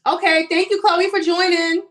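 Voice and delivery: big high-pitched welcome; excited thanks. Real, not acted. Calling thanks to a live viewer.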